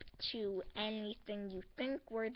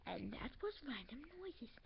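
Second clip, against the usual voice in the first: breathy whispered aside; whispering the wrap-up to the viewers; 12 dB quieter than usual. Real, not acted. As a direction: drop to a secret whisper. Whispered sign-off after noise bit.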